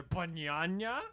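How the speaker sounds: mock yelling. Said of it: "just yelling at each other".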